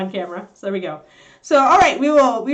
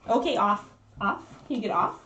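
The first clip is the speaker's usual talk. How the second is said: ordinary talk, nothing marked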